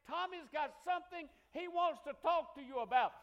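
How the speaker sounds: loud announcement. Retold or imitated. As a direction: hand him over with a flourish.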